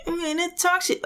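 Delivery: very high, squeaky rapper mimicry; thin little high whine, mimicking a rap line; whiny rapper. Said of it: "the way he just says".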